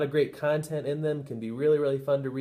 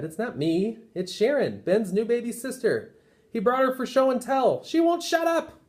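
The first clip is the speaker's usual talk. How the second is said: rising frazzled complaint; flustered teacher; 10 semitones higher than usual. Acted. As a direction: build from excuse to exasperation. Teacher pleads to the principal.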